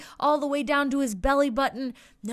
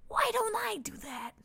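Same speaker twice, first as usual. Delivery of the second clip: high whispered wondering; hushed little idea; 9 semitones higher, 7 dB quieter than usual. A narrator's reading. Little friend deciding to dress up, high hushed whisper. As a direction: whisper it like a shy little one getting an idea.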